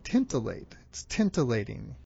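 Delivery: "tintillate" and "tintillating" both begin TINT; extra N. Shown as a demonstration. Showing the slip, "tintillating" with extra N. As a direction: put an N in the first syllable of "tintillate", "tintillating". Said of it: "slip in the extra N".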